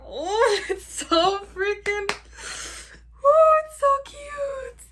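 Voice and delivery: high, loud, slow delighted squeal; excited squeal. Real, not acted. Gushing over her new MacBook, loud high squeal. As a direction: squeal over something adorable.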